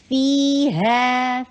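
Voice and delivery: فی ہے recited slowly, فی held two counts long; measured recitation of فی ہے; slow tajweed demo. Shown as a demonstration. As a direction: stretch فی ہے out like Quran recitation. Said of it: "فی ٹو حرکہ long".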